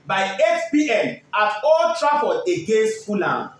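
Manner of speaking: upbeat pilot-style announcement, slowed; radio announcer. Acted. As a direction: hype the flight to Europe like an airline captain. Radio host's airline-style sports link.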